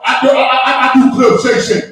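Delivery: loud, high, stammering sports-host voice; Shannon Sharpe. Acted. Podcast host plugging club Shay Shay, loud stammering impression. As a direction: stammer into it at full volume. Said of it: "you Shannon Sharpe".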